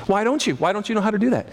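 ordinary talk, nothing marked

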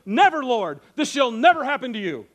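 loud, emphatic vow; forceful mock-heroic protest; Peter, bold. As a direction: boldly declare it, stepping up as protector. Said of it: "bold Peter steps up".

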